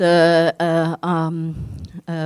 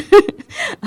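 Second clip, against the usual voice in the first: embarrassed giggle; 14 semitones higher than usual. Real, not acted. Embarrassed at own French.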